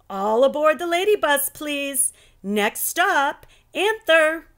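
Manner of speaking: high, loud call like a conductor; teacher calling all aboard. Acted. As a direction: announce the next stop.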